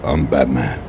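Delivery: deep, low Batman growl. Retold or imitated. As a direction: rumble it like the caped hero. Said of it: "funny when he said that on the movie".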